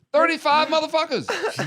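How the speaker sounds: high, laughing blurt; laughing punchline. Real, not acted.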